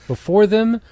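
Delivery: ordinary delivery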